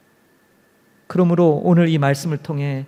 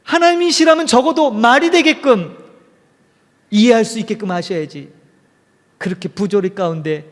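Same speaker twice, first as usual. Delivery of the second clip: high, indignant challenge to God, much higher pitch; angrier nun; 6 semitones higher than usual. Retold or imitated. Teresa the nun scolding God, high and indignant. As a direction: demand an answer, fed up. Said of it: "이 수녀는 더 화가 났어요".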